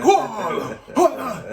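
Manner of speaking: very high, loud chimp whoops; shouted monkey hoots. Retold or imitated. Dreamer as chimp answers the other monkeys, shouted hoots. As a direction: imitate a chimp whooping back at full volume. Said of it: "I'm having this shout off with these monkeys".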